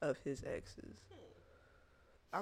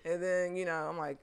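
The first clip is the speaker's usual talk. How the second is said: usual speaking voice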